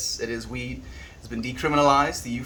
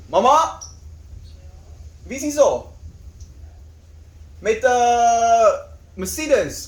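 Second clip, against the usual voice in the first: loud, high call to mom; real raised-voice shout to family; 11 semitones higher, 5 dB louder than usual. Real, not acted. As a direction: holler questions through the house. Calls to mother in another room.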